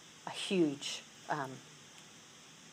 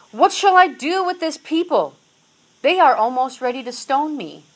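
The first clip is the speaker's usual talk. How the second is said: higher, much louder cry of a leader at wit's end; desperate cry; 7 semitones higher, 9 dB louder than usual. Acted. Moses crying out to God about the thirsty people, desperate. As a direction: shout it up to heaven for help.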